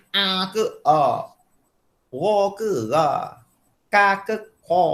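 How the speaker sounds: slow, the paired "A ke a?", "Ra ke ra?", "Ka ke ka?" each letter sounded from a different place in the throat and mouth, rising toward the end; contrasting Arabic letters. Shown as a demonstration. Teacher drills students on near-identical letters, emphatic.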